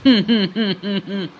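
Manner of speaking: imitating a celebrity's giggly laugh, lightly done; a mock laugh put on in his style; Rahul Mahajan laugh. Roasting the aunt, vlogger mimics Rahul Mahajan's laugh. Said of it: "या मावशीमुळे आज मला राहुल महाजन बनावं लागलं".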